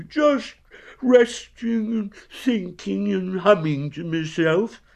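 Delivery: high, slow, airy nonchalance; careless. A narrator's reading. Pooh pretending he is only resting, careless. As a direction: stretch it out high, as if idle. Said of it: "said Winnie the Pooh carelessly".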